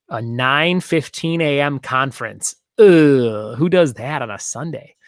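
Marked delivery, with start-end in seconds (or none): 2.8-3.6 loud exasperated ugh; real irritated groan; annoyed groan. Real, not acted. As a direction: groan at the early Sunday gig. Annoyed at Sunday morning show.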